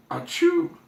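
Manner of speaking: high, loud sneeze. A narrator's reading. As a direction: sneeze it out big.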